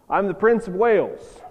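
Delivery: proudly insisting on the title; insistent. Acted. Boy insists when asked for truth.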